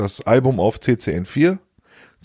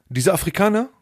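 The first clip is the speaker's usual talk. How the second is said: "dieser Afrikaner" pitched high, rising into a question; high, questioning stress on "dieser Afrikaner"; pointed emphasis; 8 semitones higher than usual. Retold or imitated. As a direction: lift the whole of "dieser Afrikaner" as if singling him out. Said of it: "warum betonst du das so".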